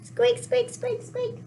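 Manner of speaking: tiny high squeaks; squeaky mouse. Acted. Mouse arriving to help, small high squeaking. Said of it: "He's a very squeaky mouse".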